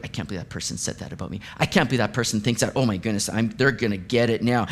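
fast, low, half-whispered fuming; mock rage. Someone fuming over an insult, hissing fast under their breath. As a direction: seethe under your breath while typing. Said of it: "written it with a bit of rage".

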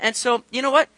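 normal talking voice

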